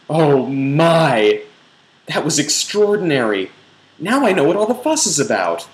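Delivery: high, loud mock rapture; breathless, over-the-top delight; hammed-up ecstatic gush. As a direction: gush it like a swooning heroine. Reader hams up ecstatic climax.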